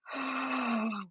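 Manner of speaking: soft, acted gasp; re-enacted gasp of surprise; shocked gasp. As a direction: draw in a stunned breath. Retold shock at proposal.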